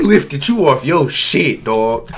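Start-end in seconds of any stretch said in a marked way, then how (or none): none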